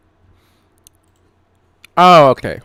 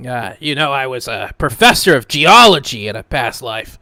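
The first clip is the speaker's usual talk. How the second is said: louder, higher know-it-all scholar voice; Alex, pompous know-it-all adventurer; 3 semitones higher, 8 dB louder than usual. Acted. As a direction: play him as a self-important scholar. GM plays Alex in character.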